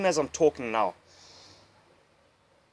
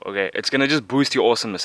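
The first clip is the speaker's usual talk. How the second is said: usual speaking voice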